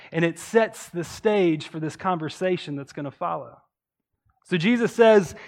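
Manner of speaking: ordinary delivery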